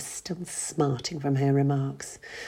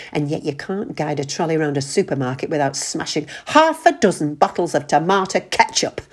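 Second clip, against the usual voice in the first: furious rant; 8 dB louder than usual. Acted. Ruth furious at John.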